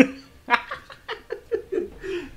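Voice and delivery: loud high cackle that falls away; real high laugh; high burst of laughter. Real, not acted. Laughs at game twist.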